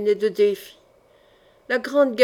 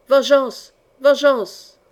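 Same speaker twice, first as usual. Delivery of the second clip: loud, raised cry for vengeance; narrated roar from afar, louder; roaring crowd cry; 3 semitones higher, 6 dB louder, much slower than usual. A narrator's reading. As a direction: call it out forcefully, drawing out the word. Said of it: "mugit la rafale".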